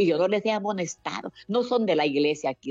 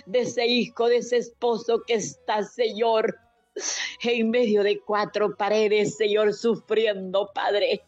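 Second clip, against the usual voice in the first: rising, pleading prayer with tearful catches; fervent prayer; 5 semitones higher, slower than usual. Acted. Woman praying for the suffering and the jailed, tearful and pleading. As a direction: pour it out to God, voice near breaking. Leads a long intercessory prayer.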